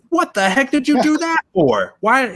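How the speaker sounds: very high, loud disbelief; shocked outburst. Acted. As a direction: squeal at the friend who jumped in. Man shocked friend jumped in.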